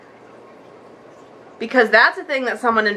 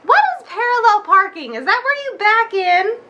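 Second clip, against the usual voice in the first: higher, puzzled voice of the lady; clueless lady; 7 semitones higher than usual. Retold or imitated. Lady asking a stranger about parallel parking, higher and baffled. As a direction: play her as baffled and naive. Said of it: "this four-year-old lady who drives is like".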